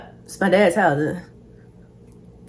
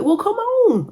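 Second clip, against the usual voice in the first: dad's voice mimicked much higher; imitating dad; 13 semitones higher, slower than usual. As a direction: play dad calling on the way, pitched way up. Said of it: "He was like".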